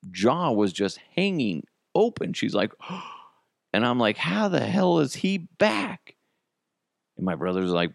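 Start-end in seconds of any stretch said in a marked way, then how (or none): 4.2-6.1 high, slow stunned question; shocked disbelief. Retold or imitated. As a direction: drag it out in disbelief. Shocked the dumped cat returned.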